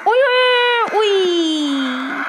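alarmed cry. Real, not acted. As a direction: yelp "uy" as the tree hits the car, then ease off. Tree smashes his car, cries out. (yüksek sesle telaşlı "uy uy" çığlığı)

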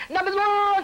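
high, loud call of a number; rattled-off call. Retold or imitated. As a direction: call it out like the clerk. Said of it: "she rattle off".

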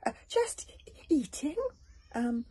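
high, hesitant, wide-eyed; innocent dragon. A narrator's reading. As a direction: play innocent, stumbling.

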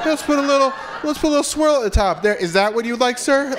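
high, prissy ice cream server; mock server voice. Comic mocking the neighbor, high prissy waiter voice. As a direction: serve it up with fake politeness, high. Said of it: "Just like".

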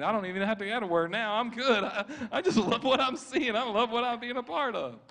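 high, loud, laughing excitement; delighted enthusiasm. Retold or imitated. Preacher voicing a man who loves being here, delighted. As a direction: imitate his thrilled thoughts, voice climbing high.

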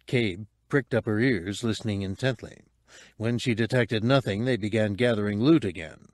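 usual speaking voice

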